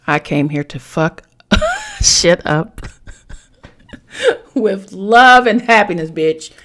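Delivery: loud, slow, laughing emphatic read; reading the card out. Shown as a demonstration. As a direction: belt the card's words out between laughs. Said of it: "the card says this".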